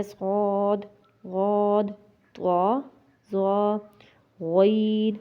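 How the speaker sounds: صاد، غاد، طا، ظا، غین each drawn out, full and rounded; heavy letters. Shown as a demonstration. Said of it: "اس کو موٹا کر کے ہم پڑھتے ہیں". (صاد، غاد، طا، ظا، غین موٹے اور کھینچ کر)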